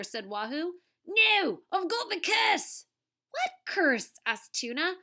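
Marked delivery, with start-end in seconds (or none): 1.1-2.9 put-on high, loud Australian-accented wail; hammed-up dread in a bogus accent; panicked refusal. TV star refuses to climb down, panicked. 3.3-4.1 high, louder puzzled cry; baffled question. Acted. Tuna questions TV star in the tree, baffled.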